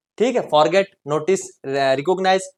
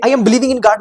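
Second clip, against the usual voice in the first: "I am believing in God" said higher and louder as the mistake; wrong form stressed; 6 semitones higher, 8 dB louder, much slower than usual. Shown as a demonstration. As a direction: push the wrong "am believing" out as the error to avoid. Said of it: "तो गलत हो जाएगा".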